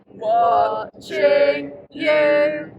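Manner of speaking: very slow drawn-out menace; creepy slow. Acted. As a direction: stretch every word slowly. Creepy slow parody line.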